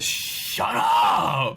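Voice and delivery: hammed-up drawn-out yell; echoing shout. Knack's shushing turned into a joke, mock echoing shout. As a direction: stretch it out like a cave echo. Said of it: "Just echoes".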